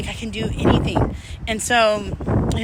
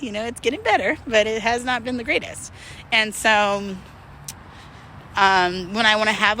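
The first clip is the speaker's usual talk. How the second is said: ordinary delivery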